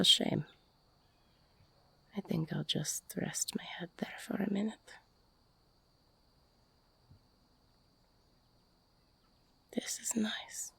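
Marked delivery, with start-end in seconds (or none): 2.2-10.7 soft quick whisper close to the ear. Acted. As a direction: breathe it right into their ear.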